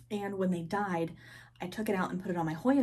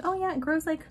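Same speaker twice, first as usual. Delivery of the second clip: breezy friend voice; 6 semitones higher, 5 dB louder than usual. Retold or imitated. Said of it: "And she's like".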